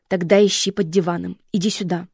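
громкий командный тон; командуй, громче к концу; резкий приказ. Acted. Мать командует. (мать громко подгоняет дочь)

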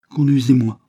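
haut et fort, en souveraine; ton de reine. A narrator's reading. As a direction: ordonne comme une reine. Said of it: "d'un ton de reine". (ton impérieux de reine)

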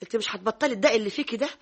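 usual speaking voice

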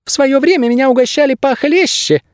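boastful, very high bird voice; proud squeaky bird. A narrator's reading. Bird guide puffing his chest, proud squeak. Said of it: "гордо выпитив грудь".

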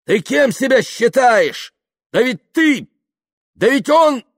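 high, loud, broken-off outbursts; furious yelling. Acted. Kaftanov indignant at Yuklin's self-importance, furious yelling. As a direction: shout as if lost for words.

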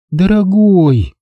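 mild sighing endearment; sighed. A narrator's reading. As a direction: breathe it out slowly, longing. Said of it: "вздохнула она".

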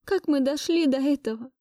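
high, aching lament; anguished appeal. Acted. Heartbroken appeal to her husband. (горестно, высоким голосом)

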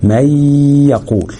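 slow مي يقول, nasal hum on the joined y; two-count ghunna. Shown as a demonstration. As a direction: hold the y of مي long and nasal into يقول. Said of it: "غنة دي مقدار حركتين".

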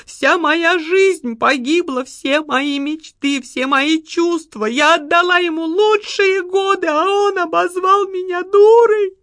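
медленно, высоко, срываясь в плач; причитать, разрыдавшись к концу; сквозь рыдание. A narrator's reading. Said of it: "проговорила Лариса Львовна сквозь рыдание". (рыдая, с нарастающим плачем)

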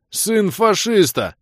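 high, slow, loud insult; angry shout. Acted. Влас curses his friend's father, shouting angrily. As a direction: stretch the insult out in a yell. Caller hurls insults on phone.

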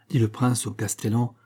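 ordinary delivery

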